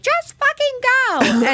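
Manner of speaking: very high, slow, drawn-out imitation of mom; imitating mom throwing him out; mock furious mom. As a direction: stretch it out like an exasperated mom. Mom kicking boyfriend out.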